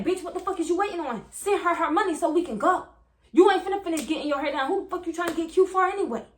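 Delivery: put-on angry boyfriend. Boyfriend yelling at girlfriend for his keys, furious. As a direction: bark it like a furious man demanding his car.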